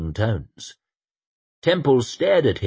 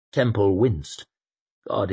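ordinary delivery

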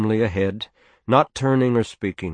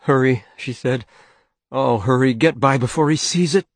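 urgent plea; 5 semitones higher, 4 dB louder than usual. A narrator's reading. Mother urgently pleads.

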